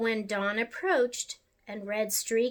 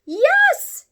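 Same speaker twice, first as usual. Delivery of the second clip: high, loud, joyful yes; aunt cheerfully agreeing; emphatic yes; 8 dB louder than usual. Acted. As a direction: cry out yes in delight. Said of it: "said her great aunt".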